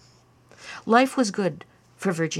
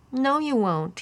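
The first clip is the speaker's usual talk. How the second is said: put-on snickering boy; 7 semitones higher, slower than usual. A narrator's reading. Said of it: "he snickered".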